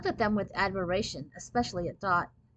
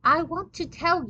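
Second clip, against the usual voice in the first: put-on higher-pitched, tentative French-accented voice; hesitant; 8 semitones higher, 5 dB louder than usual. A narrator's reading. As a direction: tread carefully, afraid to hurt feelings. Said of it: "he said hesitantly".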